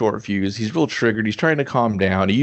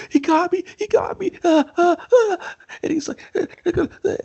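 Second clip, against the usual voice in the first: very high, hammed-up panic gasps; mock terrified cries. Ed faking an attack to his friends, mock terror. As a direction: imitate a victim's shrill panicked yelps. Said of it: "lets out this blood-curdling scream".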